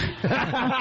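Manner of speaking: high burst of laughter. Real, not acted. Host laughs at slob jab.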